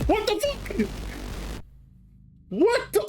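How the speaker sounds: high astonished outburst; stunned disbelief. Real, not acted. Streamer shocked by the trailer footage, high stunned disbelief. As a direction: react like you can't believe your eyes.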